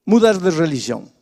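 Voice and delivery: loud, driving pulpit delivery; full-force preaching. Acted. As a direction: preach it hard to the congregation.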